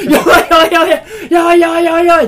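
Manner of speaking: loud, high panicked yelping; comic full-voice freak-out; re-enacted panic. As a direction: yell it over and over, blind and panicking. Acts out panic when glasses slipped.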